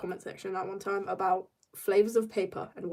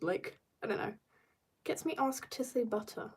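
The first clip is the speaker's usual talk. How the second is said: ordinary talk, nothing marked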